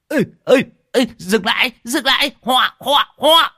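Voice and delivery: high, loud frantic hollering; yelling. A narrator's reading. Bald old rider roars up the lane, yelling to stop. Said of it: "Lão Hói gào lên ở đầu ngõ".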